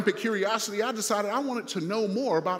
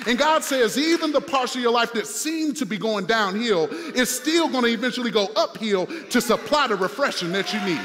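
louder preacher's cadence, half-sung at the peak; preaching at full force; 3 semitones higher, 4 dB louder than usual. Acted. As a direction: build to a singing pulpit climax. Second climax of the sermon.

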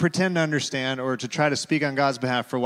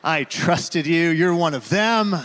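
mock betrayed toddler; 5 semitones higher, 6 dB louder, slower than usual. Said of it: "with his eyes, he's communicating".

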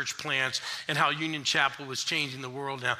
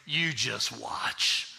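put-on old pastor; much slower than usual. Pastor telling the young preacher, slow amused. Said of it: "squeezed my knee and said".

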